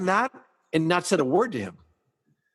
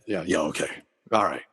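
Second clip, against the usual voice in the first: low, grudging concession; begrudging agreement; 9 semitones lower than usual. Retold or imitated. Annoyed captain agrees to start over, begrudgingly. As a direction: give in reluctantly, voice dropped right down. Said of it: "he kind of begrudgingly said".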